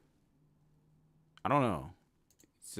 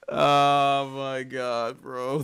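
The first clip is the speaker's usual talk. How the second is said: long, loud drawn-out groan of words; amused dismay; 3 semitones higher, 9 dB louder, much slower than usual. Real, not acted. Streamer appalled by chat's brainrot, amused dismay. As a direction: stretch it out slowly in disbelief.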